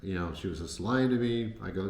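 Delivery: ordinary talk, nothing marked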